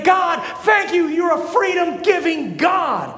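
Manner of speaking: loud, slow, high cry of thanks; grateful praise. Retold or imitated. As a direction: call it out like a freed believer. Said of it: "so that people would say".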